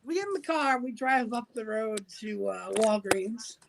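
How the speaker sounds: usual speaking voice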